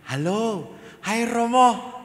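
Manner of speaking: put-on high, loud child's greeting; eager schoolchild. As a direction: call out like an excited little kid. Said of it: "Saya ketemu anak S D".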